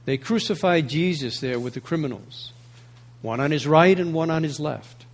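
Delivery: measured, solemn reading of scripture; gospel reading. Acted. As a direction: read it like the Gospel at the altar.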